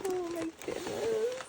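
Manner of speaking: breathless delighted gasp; high-pitched gush. Real, not acted. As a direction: squeal it out high. Gushing over swap package.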